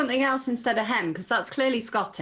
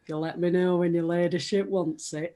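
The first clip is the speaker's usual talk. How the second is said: slightly lower, broad northern gamekeeper voice; Sean Bean; 3 semitones lower than usual. Retold or imitated. Recalling the gamekeeper in Lady Chatterley's Lover, northern impression. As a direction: quote it in a northern English accent. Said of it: "I always think of Sean Bean".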